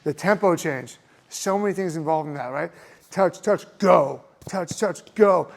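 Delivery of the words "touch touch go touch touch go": light "touch, touch", then a hard "go"; stress on the last punch. Shown as a demonstration. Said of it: "I like having the emphasis on the last punch".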